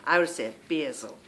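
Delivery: basil said "birzel" in a Scottish-Irish accent. Shown as a demonstration. Showing her home Scottish-Irish way of saying basil. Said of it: "very Scottish and Irishy sounding".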